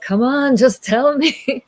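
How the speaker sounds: high, loud acted impatience; impatient pleading. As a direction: beg for the answer, exasperated. King impatient for the answer.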